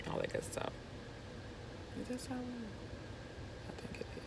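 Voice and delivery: hushed, unvoiced murmuring; whispered aside. Real, not acted. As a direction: mumble it to yourself. Mumbled aside to herself.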